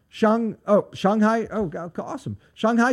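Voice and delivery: usual speaking voice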